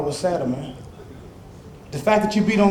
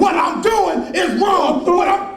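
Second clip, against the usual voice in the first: slow furious shout; 11 semitones higher, much slower than usual. Acted. Man yelling at his abusive friend, slow furious shout. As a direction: yell it slowly, word by word. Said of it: "I say it".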